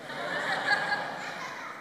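breathy chuckle of delight; pleased little laugh; airy pleased laugh. Retold or imitated. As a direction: laugh softly, flattered. Pleased by flattery.

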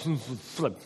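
playful page-flipping noise; page flip sound. Retold or imitated. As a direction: mimic pages riffling, flip flip flip. Imitates pages flipping.

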